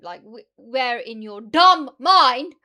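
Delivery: loud, slow, incredulous yell; loud slow rant; exasperated yell. Real, not acted. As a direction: blow up at how dumb it is.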